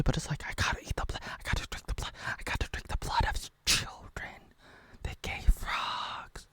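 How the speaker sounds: creepy whispered bloodlust; eerie whisper. Pretend.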